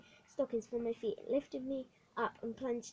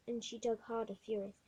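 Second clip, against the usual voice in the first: ordinary talk, nothing marked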